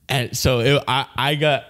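ordinary talk

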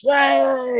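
very high mock cheer. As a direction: imitate the whole crew cheering. Crew cheering at wrap.

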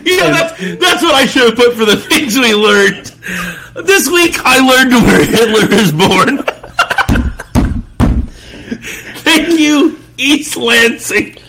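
high, laughing through the words; can barely talk for laughing; laughing hard. Real, not acted. Laughing at Hitler board clip.

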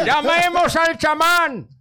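high, slow, loud call through laughter; mock villager shout. Villager panicking, calling for the shaman loudly. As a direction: cry out for the shaman. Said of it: "tú no puedes volver al pueblo".

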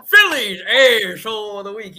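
drawn-out Philadelphia accent title call; Philly accent. Shown as a demonstration. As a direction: belt it out like a Philly announcer. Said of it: "You gotta say it with the Philly accent".

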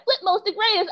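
put-on high-pitched kid quote; student's voice. Teacher quotes the little girl, high kid voice. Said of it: "when she said".

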